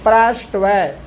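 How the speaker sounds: ordinary delivery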